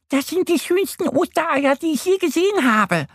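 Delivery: very high squeaky duck voice; gushing little duck. A narrator's reading. Annie the duck admiring the eggs, gushing. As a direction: rave in a high cartoon squeak. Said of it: "schwärmt Annie".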